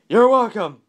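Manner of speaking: very loud, high reply; shout. A narrator's reading. Mister Yell answering a shopper's thanks, shouting. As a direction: holler the polite answer. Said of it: "shouted Mister Yell".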